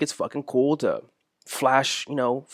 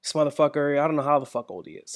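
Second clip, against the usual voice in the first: ordinary talk, nothing marked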